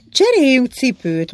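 peremptory and insistent; brooking no argument. A narrator's reading. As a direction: say it as a command that allows no refusal. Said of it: "ellentmondás nem tűrő hangon".

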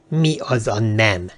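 slow, drawn-out puzzled question; taken aback. A narrator's reading. Sir Winston hearing a sudden no, slowly taken aback. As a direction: stretch out the question, baffled. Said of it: "aztán megütődve".

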